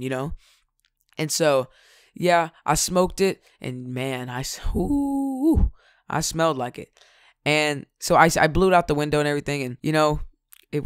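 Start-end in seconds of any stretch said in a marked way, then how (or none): 4.7-5.7 high, drawn-out hoot; long "ooh". Retold or imitated. Recalling the first smoke to viewers, high drawn-out ooh. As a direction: let out a high, stretched whoop.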